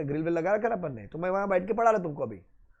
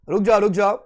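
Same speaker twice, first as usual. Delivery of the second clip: louder stop-stop to the class; raised voice halting students; firm call to stop; 2 semitones higher, 7 dB louder, faster than usual. Real, not acted. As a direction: hold them back firmly. Stopping impatient students.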